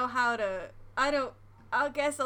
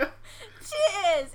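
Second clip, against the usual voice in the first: "Cheers" squeezed out through laughter, higher; laughing cheers; 9 semitones higher, 3 dB louder than usual. Shown as a demonstration. American laughing through a British "cheers", laughing attempt. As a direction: try cheers again mid-giggle. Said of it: "sounds like I'm saying chairs really weirdly".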